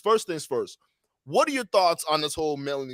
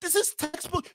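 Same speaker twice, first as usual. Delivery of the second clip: high-pitched outraged exclaim; a real, incredulous high cry of outrage; shocked indignation, way up high; outraged disbelief; 10 semitones higher, 4 dB quieter than usual. Real, not acted. Host outraged at guest.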